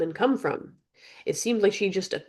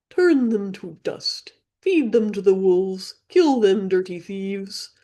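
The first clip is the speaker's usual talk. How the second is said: slow, louder croaking bird command; menacing raven; 2 semitones higher, 3 dB louder, slower than usual. Acted. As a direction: gloat like a wicked raven.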